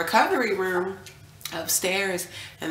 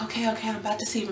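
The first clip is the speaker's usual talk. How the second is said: rushed nervous excitement; 4 semitones higher, much faster than usual. Retold or imitated. Nervous build-up before birth.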